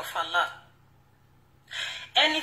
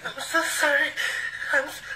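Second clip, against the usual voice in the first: sobbing apology that breaks off; tearful, breaking down mid-sentence; real crying. Real, not acted. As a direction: plead for forgiveness through tears. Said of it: "heartbreaking to see her cry".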